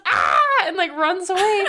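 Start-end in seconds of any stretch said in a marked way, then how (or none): 0.1-0.4 loud, sudden hammed-up yelp; mock shout scream. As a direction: blurt out a fake scream. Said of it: "screams and goes".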